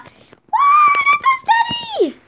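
high, loud squealing cheer; excited cheering. Real, not acted. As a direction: shriek with joy. Cheering as [name].

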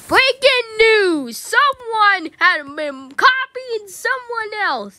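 news anchor. Pretend. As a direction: announce it like a TV anchor. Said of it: "I'm turning this to the news".

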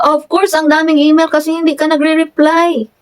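high-pitched, indignant acted comeback; exasperated retort. Client answering the complaint about emails, exasperated retort. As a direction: throw the complaint back, exasperated.

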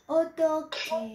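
Ô, tôi kề drawn out with a clear khờ sound; kề spoken as khờ, slow and deliberate; slow careful pronunciation. Shown as a demonstration. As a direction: say Ô, tôi kề slowly, giving kề its full khờ. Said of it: "phải âm khờ nha".

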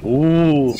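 breathy smug ooh; a smug superhero's ooh; Homelander. Retold or imitated. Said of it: "Respond with Homelander going".

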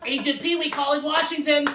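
put-on high, shouting manic man-child; Pee Wee Herman impression. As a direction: shout it out frantic and silly. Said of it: "This is my Pee Wee Herman".

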